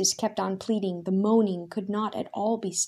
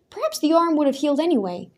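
higher, louder, fast objection; doubting; 7 semitones higher, 5 dB louder, faster than usual. A narrator's reading. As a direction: rush out a sceptical excuse. Daniel doubting the miracle.

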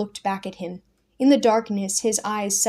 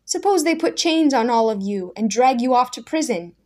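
louder, higher anxious challenge; Daniel challenging Simon, raised and alarmed; 4 semitones higher, 6 dB louder than usual. Acted. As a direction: press him hard with the danger.

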